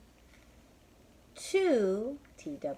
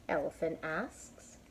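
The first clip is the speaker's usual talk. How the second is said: plain reading voice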